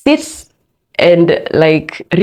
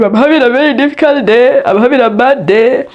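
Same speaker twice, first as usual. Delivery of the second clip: tearful reply; 7 semitones higher, 3 dB louder than usual. Retold or imitated. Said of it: "I was just crying".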